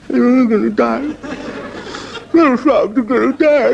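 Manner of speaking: high, slow, mock-tearful frog wail; a hammed-up little sob of self-pity; frog weeping. Lonely frog by the river wailing in mock tears. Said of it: "Little tears".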